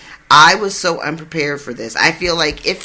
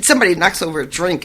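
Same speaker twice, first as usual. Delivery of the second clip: normal talking voice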